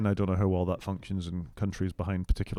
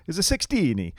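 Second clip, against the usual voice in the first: a lightly put-on Italian accent, pitched higher; 9 semitones higher than usual. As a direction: imitate the Italian co-host's accent, half-hearted. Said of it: "in his Italian accent, and I'll try not to butcher it".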